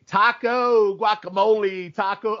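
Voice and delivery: mock the fan's insensitive yelling. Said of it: "your typical, like, redneck".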